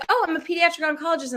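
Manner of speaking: mock-casual job reveal; breezy self-introduction. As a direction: toss it off fast and high. Acting her own small-talk answer.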